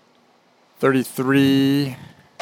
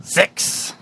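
flower voice; 6 semitones higher than usual. Pretend. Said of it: "talk in flower voice".

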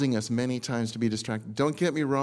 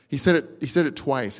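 ordinary talk

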